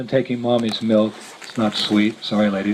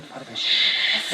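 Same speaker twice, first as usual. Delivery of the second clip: whispered shush through laughter; hushed shush; 2 semitones lower, 5 dB quieter, much slower than usual. Retold or imitated. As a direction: hush him, half laughing. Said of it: "I said".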